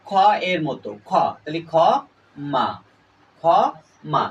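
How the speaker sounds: ক্ষমা said as "khoma", split into খ and মা; spoken pronunciation. Shown as a demonstration. Teacher shows students that ক্ষমা is said "khoma". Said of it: "ক্ষমার উচ্চারণটা আমি করব".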